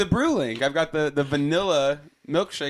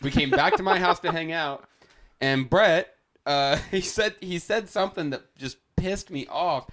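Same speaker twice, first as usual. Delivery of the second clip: normal talking voice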